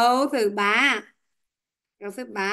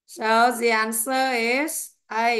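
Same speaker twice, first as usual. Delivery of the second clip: slow, deliberate; slower than usual. Shown as a demonstration. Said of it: "teacher gives answer slowly".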